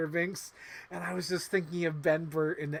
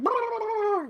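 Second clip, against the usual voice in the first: very high, loud mock Jar Jar trill; Jar Jar noise; 8 dB louder than usual. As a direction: trill it up high like a goofy alien. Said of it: "We missed the Jar Jar Binks going".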